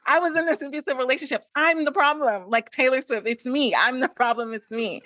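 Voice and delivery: high, fast hammed-up insistence; playful overwrought self-accusation; mock pleading. Hammed-up insistence on own guilt.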